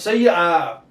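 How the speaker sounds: usual speaking voice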